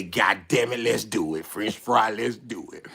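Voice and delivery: low, soft acted resolve; quiet, low pep talk; low-key agreement. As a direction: agree under your breath. Acted agreement, first said under breath.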